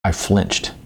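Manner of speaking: ordinary delivery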